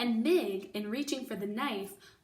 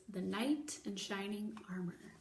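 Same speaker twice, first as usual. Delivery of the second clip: whisper; 7 dB quieter than usual. Real, not acted. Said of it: "teaser of next chapter".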